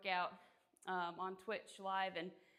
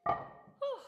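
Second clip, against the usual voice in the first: 1 loud exhale; 7 dB louder than usual. Real, not acted. Exhaling mid workout stream.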